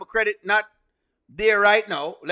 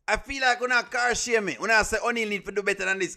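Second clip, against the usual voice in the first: ordinary talk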